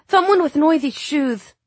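put-on high child voice with a lisp. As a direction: keep the little boy's lisp. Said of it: "he lisped".

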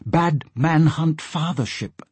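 lightly voiced porpoise, words drawn out; porpoise speech. Porpoise warns Asta of a bad man, slow porpoise speech.

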